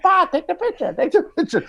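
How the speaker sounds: put-on high squeaky baby voice; baby Bigfoot voice. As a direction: squeak it like a tiny creature. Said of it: "He's like".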